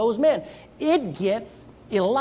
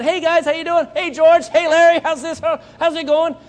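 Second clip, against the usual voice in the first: hearty greeting; 6 semitones higher, 6 dB louder than usual. Acted. David greeting his brothers, acted.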